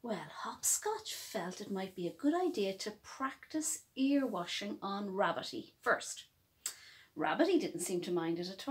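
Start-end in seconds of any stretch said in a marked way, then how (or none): none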